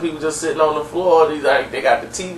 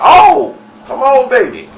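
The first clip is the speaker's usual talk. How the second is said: high, loud mock eagerness; eager shout; 10 semitones higher, 8 dB louder than usual. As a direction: leap in with a big excited holler. Dirty jumps up eagerly.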